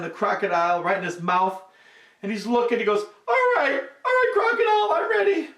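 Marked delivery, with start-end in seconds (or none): 3.3-5.5 high, loud psyched-up call; excited monkey shout. A narrator's reading. Monkey about to leap onto the crocodile, high shout. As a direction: pump yourself up, way up high.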